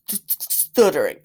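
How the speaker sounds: "Stuttering" with the first sound repeated over and over; slow, deliberate stutter into "Stuttering"; put-on stutter. Shown as a demonstration. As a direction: stammer on the start of "Stuttering", drawn out. Mimics a stutter after discussing stuttering.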